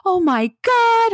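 slow, high-pitched drawn-out delight; thrilled reaction. Retold or imitated. Acted shared excitement over passing.

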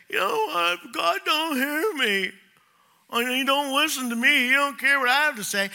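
high, put-on voice of a sulking believer; whiny complainer voice. Preacher mimicking a sulking believer, high and whiny. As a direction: whine it like someone who feels ignored by God. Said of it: "People will say".

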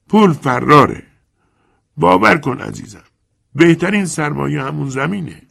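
put-on old moneylender, coaxing. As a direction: sweet-talk him with false warmth. Chiarenza coaxing.

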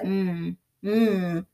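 soft closed-mouth mm grunts, mimicking; a lightly voiced man who barely talks; imitating a grunt. Mocking how he answers her, soft grunting hums. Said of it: "that's how he talks".

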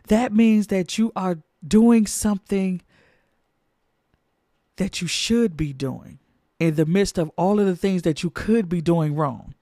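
ordinary talk

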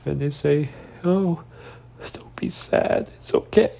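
choked, high sobbed words; real crying. Real, not acted. Repeating an ex's hollow reassurance, crying hard. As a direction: break down while repeating empty comfort. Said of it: "I'm a very emotional person".

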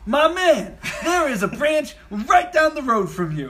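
high, loud bro enthusiasm; hyped-up boss. Retold or imitated. Branch head delivering good news to recruit, hyped-up and high. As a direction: sell the good news with mock excitement. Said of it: "He looks through and he goes".

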